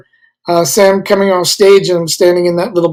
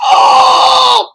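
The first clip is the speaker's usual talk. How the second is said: high piercing shout; Kinison yell; 5 dB louder than usual. Retold or imitated. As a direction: let out a huge scream. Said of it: "I'm doing the yells".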